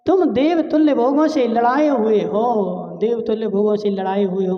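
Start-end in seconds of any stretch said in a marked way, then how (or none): 0.1-2.7 lightly acted plaintive mother; mother's lament. Mother reminding pampered sons, mild lament. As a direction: plead with the sons, a touch of wailing. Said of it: "रोते रोते क्या बोल रही है".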